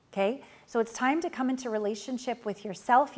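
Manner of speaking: normal talking voice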